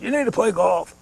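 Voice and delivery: a hint of pushy in-law advice; insistent father-in-law. Father-in-law tells him to take up golf, insistent.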